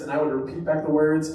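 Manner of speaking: ordinary talk, nothing marked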